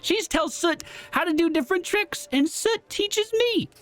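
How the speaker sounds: put-on high, dreamy young elf girl; Ember voice. Player voices Ember character.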